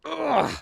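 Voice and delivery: high strained grunt under the weight; a sharp grunt of effort. Real, not acted. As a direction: grunt as the load bites in. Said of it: "this is heavy".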